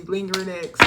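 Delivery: normal talking voice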